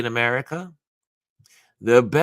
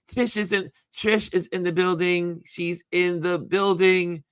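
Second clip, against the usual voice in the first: sing-song announcement; 9 semitones higher than usual. Real, not acted. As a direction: sing it out like a host announcing a guest. Announcing viewer arrival.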